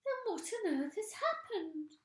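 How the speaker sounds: put-on high, loud alarmed old woman; alarmed cry. A narrator's reading. Mrs Twit crying out in alarm to her husband. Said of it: "cried Missus Twit".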